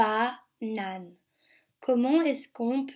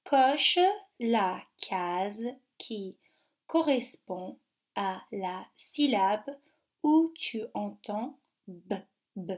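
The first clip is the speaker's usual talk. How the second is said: "la case qui correspond à la syllabe" read out slowly, word by word; slow reading aloud; slower than usual. Shown as a demonstration. Teacher reading the worksheet instruction slowly to pupils. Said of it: "ça dit".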